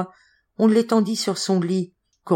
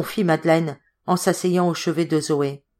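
plain reading voice

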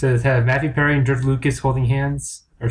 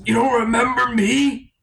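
high villain voice; 9 semitones higher than usual. Acted. Villain voice continues.

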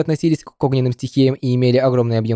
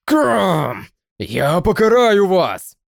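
high, slow menacing monster voice; drawn-out threat from a beast; fire-breathing dragon; 7 semitones higher, much slower than usual. Acted.

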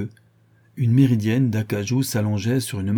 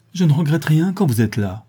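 higher, fervent declaration; passionate; 6 semitones higher, 4 dB louder than usual. A narrator's reading. Woman declaring love to Lupin, passionate. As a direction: let it swell with passion. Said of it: "dit-elle passionnément".

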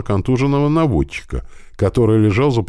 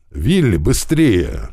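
slow, drawn-out urgent call; narrated shout; 2 semitones higher, much slower than usual. A narrator's reading. Deafened lieutenant urging on his corporal, mild drawn-out shout. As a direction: call out the order, understated shout. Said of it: "кричал оглушённый новым ударом лейтенант".

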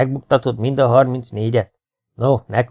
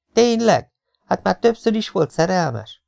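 much higher, astonished "really?"; surprised pupils; 8 semitones higher than usual. Acted. Students surprised by the teacher's answer, higher and astonished. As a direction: jump up in mock amazement.

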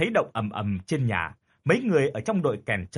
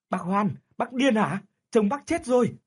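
shocked shout; 8 semitones higher than usual. A narrator's reading. Restraining the frantic widow.

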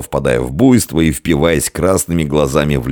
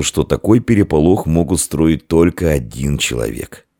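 ordinary delivery, nothing marked

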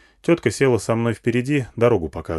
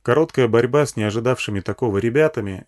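ordinary delivery, nothing marked

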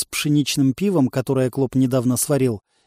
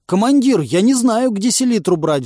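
high, loud pleading whine; 7 semitones higher, 5 dB louder than usual. A narrator's reading. Cornered man begging the commander, pleading. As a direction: plead with the boss, pitched up. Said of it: "взмолился он".

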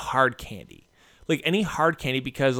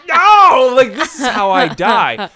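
much higher, louder, slowed mock-panic cry; mock panicked yell; 10 semitones higher, 14 dB louder, slower than usual. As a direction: ham up the dying-kid panic. Retells panicking while choking.

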